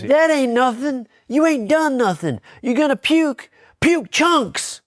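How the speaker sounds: high, far-off drill-sergeant bark; Sarge yelling. Retold or imitated. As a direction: bark it like a furious wrestling coach. Sarge mocking his vomit.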